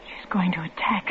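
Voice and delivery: hushed, scared whisper; frightened whisper. Acted. Wife whispers her fear to her husband. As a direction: breathe the warning in terror.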